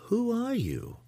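high-pitched curious question with a steep fall. A narrator's reading. Matron questions a suspected impostor, curious and high. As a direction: ask it high and curious, unconcerned. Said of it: "her voice sounding more curious than concerned".

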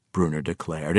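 ordinary delivery

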